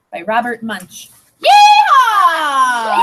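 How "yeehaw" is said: high whooping yeehaw; cowboy yell. Pretend. Teacher whooping to start the story. Rounding up authors cowboy call.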